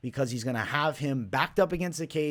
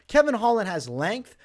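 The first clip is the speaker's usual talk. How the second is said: ordinary delivery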